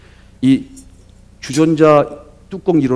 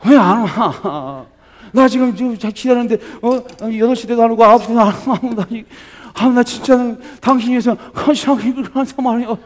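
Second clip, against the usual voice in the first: higher, cutesy coaxing complaint; coaxing whine; 8 semitones higher, 3 dB louder than usual. Shown as a demonstration. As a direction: sulk sweetly to win him over. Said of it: "이 콧바람을 넣어야 돼". (콧소리 섞인 애교 투정)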